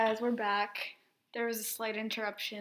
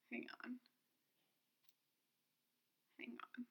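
whisper; 17 dB quieter, much faster than usual. Real, not acted. Whispered while pulling up calculator.

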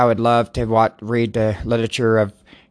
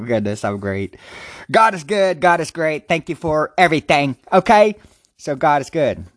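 ordinary talk

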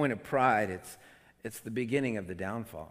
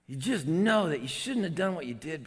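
ordinary talk, nothing marked

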